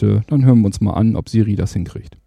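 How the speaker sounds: ordinary talk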